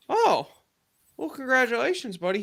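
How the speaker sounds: high-pitched, taken-aback reaction, retold; lifted, caught-off-guard well-wishing; surprised congratulations. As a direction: reenact pleasant surprise at a friend's news. Reacting to baby news, retold.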